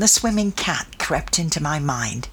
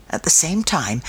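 ordinary delivery, nothing marked

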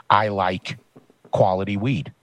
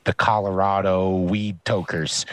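usual speaking voice

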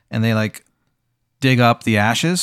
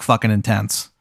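normal talking voice

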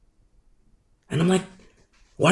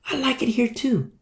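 higher and softer reply; soft agreement; 7 semitones higher, 7 dB quieter than usual. Retold or imitated. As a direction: agree gently, half under the breath. Fellow servant voiced in parable.

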